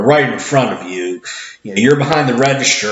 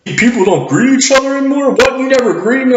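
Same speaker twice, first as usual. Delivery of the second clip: higher, fast, accusing complaint; indignant customer; 13 semitones higher, 4 dB louder, faster than usual. Retold or imitated. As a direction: play the offended lady snapping at him. Rude customer accuses cashier.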